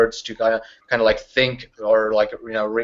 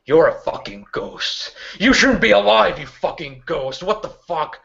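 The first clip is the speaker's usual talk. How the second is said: high burst then dropping to a hiss; a woman shocked and furious; angry disbelief; 5 semitones higher than usual. Acted. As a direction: spit the words at the ghost. NPC yells at ghost.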